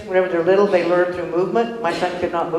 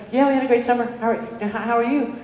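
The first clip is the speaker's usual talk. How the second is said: polite reply; 7 semitones higher than usual. Retold or imitated. Her own reply retold.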